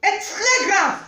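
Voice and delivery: high, loud warning; alarmed outcry. Acted. Alarmed at sister's so-called illness.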